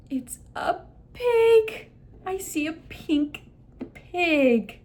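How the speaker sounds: slow, high, drawn-out "pig"; emphasis on PIG. Shown as a demonstration. Clinician reveals the toy to the child, emphasizing PIG. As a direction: stretch the phrase and punch "pig" high. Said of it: "emphasize the word pig".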